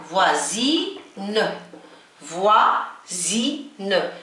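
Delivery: French "voisine" broken into syllables, buzzing Z in the middle; teacher's slow model of "فوازين", each syllable set apart; Z pronunciation. Shown as a demonstration. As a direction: say "فوازين" in slow pieces, "voi-zi-ne", with a voiced Z. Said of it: "ننطقه ز".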